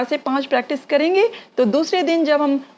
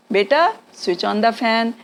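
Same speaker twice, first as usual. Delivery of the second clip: put-on slow demo to a child; 2 semitones lower, much slower than usual. Shown as a demonstration. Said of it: "नाम लेके बोल दीजिए".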